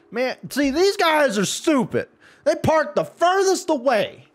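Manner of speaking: high, straining rant; exasperated berating. Real, not acted. Gamer berating drivers who park far away, exasperated. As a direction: rail at them in a high voice.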